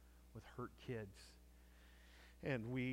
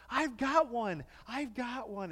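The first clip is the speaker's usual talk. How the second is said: much higher and louder, thrilled; excited shout; 12 semitones higher, 13 dB louder, slower than usual. Retold or imitated. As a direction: shout it like a delighted girl. Jen's excited exclamation retold.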